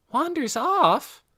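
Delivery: high, slow astonished echo; startled query. A narrator's reading. Girl hearing the patient wanders, high and surprised. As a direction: repeat it high in surprise, drawn out.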